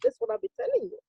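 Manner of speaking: high, pointed retort; mock scolding. Telling Chief the same thing again, high mock scolding. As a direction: repeat yourself pointedly. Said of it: "And I said".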